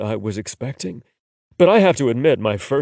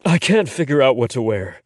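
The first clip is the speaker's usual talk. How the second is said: ordinary delivery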